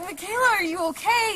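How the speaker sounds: high-pitched, retold cry of concern; worried calling out. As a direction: call out as if checking on a hurt friend. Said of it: "we're super worried about her".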